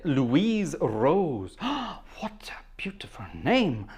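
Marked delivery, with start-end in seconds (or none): 1.6-3.9 gasp, then whisper it slowly in awe; breathless little whisper of wonder; hushed gasp of admiration; awed whisper. Real, not acted. Awed by a viewer's name.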